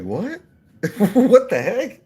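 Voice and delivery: high, incredulous imitation, stretched with a laugh; amused, squeaky disbelief; mock bafflement. Baffled bystanders' reaction acted out.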